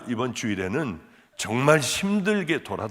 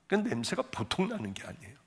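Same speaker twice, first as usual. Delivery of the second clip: ordinary delivery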